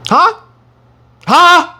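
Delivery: sharp high shout; loud, high "Huh?" demanding an answer; loud challenge. Real, not acted. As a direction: throw it at the crowd. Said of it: "preacher challenges congregation".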